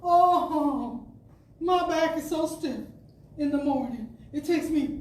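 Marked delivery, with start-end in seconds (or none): none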